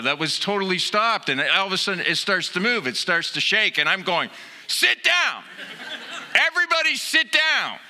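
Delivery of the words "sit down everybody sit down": high, frantic mock yell at the others; panicked yell. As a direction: shriek at everyone to sit before it falls. Panicked in shaking gondola.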